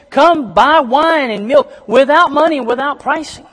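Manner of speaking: high, loud preaching call; proclaiming scripture. Acted. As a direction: cry it out like a prophet. Reading scripture call aloud.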